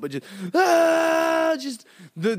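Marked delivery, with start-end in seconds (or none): 0.5-1.6 long, very high mock squeal; imitated high scream. Sister screaming after hitting the pole, long mimicked shriek. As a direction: stretch out a piercing child's scream. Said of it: "the highest, longest scream".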